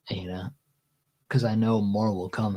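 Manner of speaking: usual speaking voice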